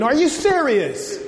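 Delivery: drawn-out, high-pitched disbelief; incredulous. Acted. Preacher reacts to people crying and weeping, incredulous. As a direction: stretch it out, pitch jumping up in disbelief.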